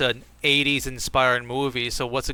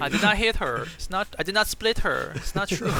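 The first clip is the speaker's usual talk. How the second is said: high-pitched mock foreign-accented protest; Tommy Wiseau; 6 semitones higher than usual. Friend riffs on a famous bad-movie denial, Tommy Wiseau impression. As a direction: parody the odd accent of a hammy film actor. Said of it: "He's not Tommy Wiseau".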